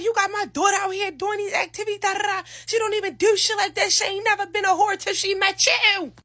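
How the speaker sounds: a hint of an outraged father; mock angry father. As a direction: rant like a furious father. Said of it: "He talk about".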